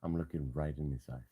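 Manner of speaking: soft hushed voice. Real, not acted. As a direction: talk low beside the cat. Cuddling the sleepy cat.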